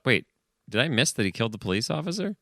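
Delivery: normal talking voice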